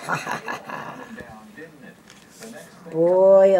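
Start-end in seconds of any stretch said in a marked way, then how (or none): 0.0-1.0 breathy evil laugh fading out; villain laugh. A narrator's reading. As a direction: cackle in triumph, dying away.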